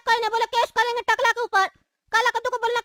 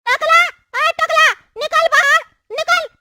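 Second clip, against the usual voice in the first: raised, higher-pitched challenge; aggressive calling out; 3 semitones higher than usual. Acted. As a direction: shout him out of his house. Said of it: "जो तुम निकल के आके भौंक रहा है।". (ऊँची आवाज़ में ललकारना)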